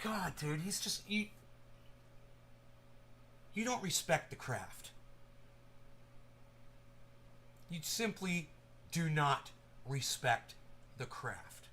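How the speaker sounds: hushed movie-quote delivery, drops away at the end; soft, disdainful movie quote; quoting a movie line. Retold or imitated. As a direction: murmur the scolding line like a film character. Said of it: "Name that movie".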